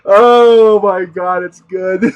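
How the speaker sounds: long, loud, high opening sigh of glee; laughing delight. Real, not acted. Savoring the squirrel story, laughing delight. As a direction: draw it out, spent from laughing.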